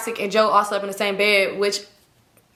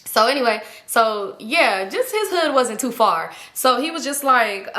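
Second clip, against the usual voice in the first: usual speaking voice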